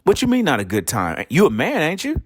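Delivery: high, incredulous wife voice; wife's outraged voice. Retold or imitated. Wife challenges his manhood in a high disbelieving voice. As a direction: jump up high in disbelief at him. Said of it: "She say".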